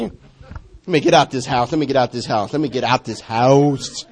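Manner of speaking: low, slow, quiet grumble; muttered to self. Retold or imitated. Preacher retelling his irritated morning, a low slow grumble. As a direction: mutter it under your breath, slowly. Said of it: "I don't wanna tell you what I said".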